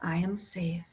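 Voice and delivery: whispered affirmation. Shown as a demonstration. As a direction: breathe the words with no voice. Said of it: "say that in your mind or out loud".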